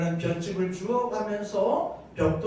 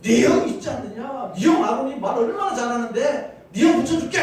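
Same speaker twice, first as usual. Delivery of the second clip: loud, raised-pitch scolding; heated, high and loud; angry outburst; 6 semitones higher, 6 dB louder than usual. Acted. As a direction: burst out in anger at a stubborn servant. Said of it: "화를 확 내는 겁니다".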